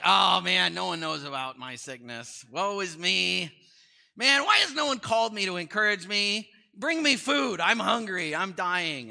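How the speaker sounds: loud, high, whiny mock moaning, slow on woe is me; self-pitying sick man. Preacher mimics a sick man craving attention, loud mock whining. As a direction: ham up a sick man begging for sympathy. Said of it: "The guy preaching was saying".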